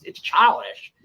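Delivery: usual speaking voice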